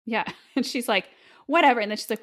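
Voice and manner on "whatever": loud, high brush-off; who cares; dismissive. Acted. Dismissive heroine acted.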